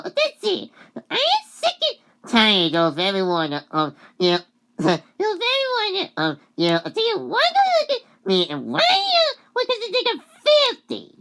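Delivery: very high, loud acted rant; hammed-up high-pitched fury; angry. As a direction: rail at someone, imitating an offended character. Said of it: "and she gets all angry".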